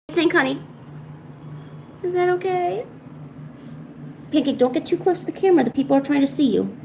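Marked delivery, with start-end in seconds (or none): none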